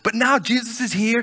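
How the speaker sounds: ordinary talk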